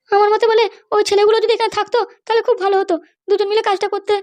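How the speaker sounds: a high female character voice; mother's voice. Acted. One actor voicing the mother.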